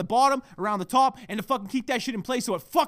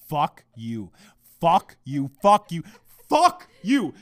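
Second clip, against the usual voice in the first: escalating cursing; 4 semitones lower, 4 dB louder, much slower than usual. Real, not acted. Podcaster answering fiancée's non-apology, escalating anger. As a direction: build each repeat toward an outburst. Repeated curses, building in anger.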